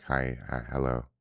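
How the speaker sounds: mock-nervous self at a video interview, low and soft; sheepish greeting. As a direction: shrink into an awkward meek greeting. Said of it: "I'm like".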